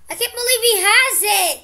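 loud, high-pitched astonishment; amazed disbelief. Real, not acted. Gamer sees another player has it, amazed disbelief.

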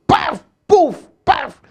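punchy high-pitched whacks; imitated strap blows. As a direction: voice each smack of the strap.

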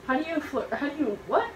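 baffled. Real, not acted. Baffled by direction.

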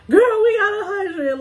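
high, loud excited squeal. Retold or imitated. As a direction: shout the good news, thrilled. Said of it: "I was texting Mary like".

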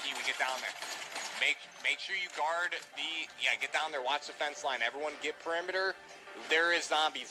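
usual speaking voice

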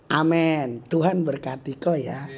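softly forgiving answer to an insult; gentle blessing. Retold or imitated. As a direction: bless them back quietly and kindly. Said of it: "Kau balas".

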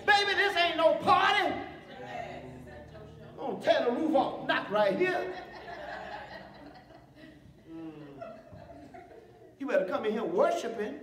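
loud, hyped-up sermon voice; fiery preaching. Acted. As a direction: preach it hard to the congregation. Preaching at full force.

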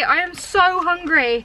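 slow, drawn-out whine on "so hungry"; whiny. Real, not acted. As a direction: stretch "I am so hungry" like a hungry moan.

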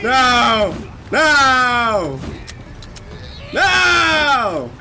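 panicked no. Real, not acted. Begging under attack.

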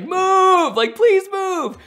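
high, loud pleading shout; desperate yell. Retold or imitated. Yelling at the cars ahead to move aside. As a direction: yell it like nobody can hear you. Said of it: "like, yelling's gonna do anything".

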